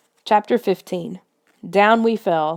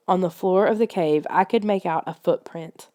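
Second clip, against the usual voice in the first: ordinary delivery, nothing marked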